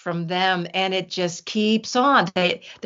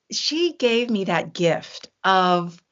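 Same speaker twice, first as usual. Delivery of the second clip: usual speaking voice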